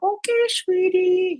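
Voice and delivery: high, slow humoring sweetness; patronizing mom. Retold or imitated. Mom humoring her daughter, high and slow. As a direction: humor the kid gently. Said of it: "And I'm like".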